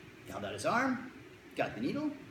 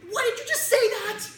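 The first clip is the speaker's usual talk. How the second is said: put-on high, louder panicky kid voice; alarmed kid; 7 dB louder than usual. Kid alarmed at the doctor's words, high panic. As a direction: squeak it like a startled boy.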